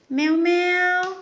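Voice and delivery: high playful meow; cat meow imitation. Shown as a demonstration. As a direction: meow like a kitten for a toddler. Parent imitating cat for toddler.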